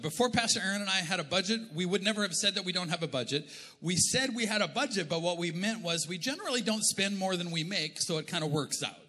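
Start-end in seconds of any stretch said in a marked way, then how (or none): none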